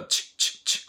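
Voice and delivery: "チッチッチッ" as sharp bursts with no voice; voiceless ch sound. Shown as a demonstration. Teacher showing the ch of children, voiceless "チッ". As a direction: click out "チッ" three times, just air. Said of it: "この音ね".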